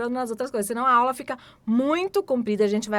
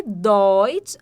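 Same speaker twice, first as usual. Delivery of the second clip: loud model; 8 dB louder than usual. Shown as a demonstration. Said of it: "alemão".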